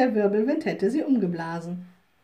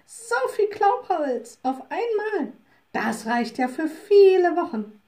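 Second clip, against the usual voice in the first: delighted wood women; 7 semitones higher, 5 dB louder than usual. Acted.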